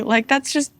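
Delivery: ordinary talk, nothing marked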